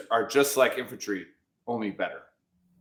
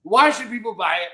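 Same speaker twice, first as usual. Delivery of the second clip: hyped questions; 7 semitones higher, 6 dB louder than usual. Real, not acted. Host questions guest, hyped.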